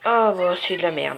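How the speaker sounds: loud, annoyed burst; blurting out disappointment; frustrated outburst. Real, not acted. Loses the draw, frustrated.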